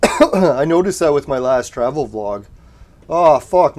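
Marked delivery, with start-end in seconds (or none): 3.1-3.8 surprised outburst. Real, not acted. Team just died in game.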